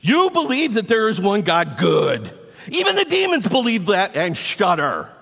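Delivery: high, loud, condescending rebuke; pointed scolding tone. Acted. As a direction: quote it like a sharp telling-off. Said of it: "you can hear the tone in his voice".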